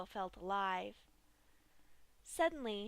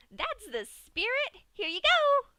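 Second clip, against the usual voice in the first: cheering Pinkie; 12 semitones higher, 12 dB louder than usual. A narrator's reading. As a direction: cheer and thrust it forward. Pinkie cheers, hands cupcake.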